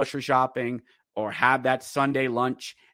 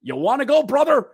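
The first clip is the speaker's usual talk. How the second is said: high, louder tough-guy challenge; fight challenge; 10 semitones higher, 6 dB louder than usual. Retold or imitated. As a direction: dare him to fight, brother. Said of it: "Zane Wisdom's like".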